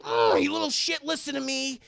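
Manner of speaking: high, loud mock-angry snap; exasperated outburst. Chucky snapping at little Tyler, exasperated outburst. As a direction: lose patience with the kid, loudly.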